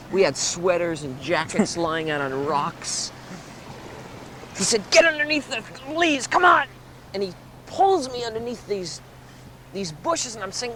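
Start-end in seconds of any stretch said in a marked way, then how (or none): 4.9-6.7 high, increasingly loud plea; panicked urging. Acted. Friend hurrying teller under bushes, acted panic. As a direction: urge them into hiding, high and building.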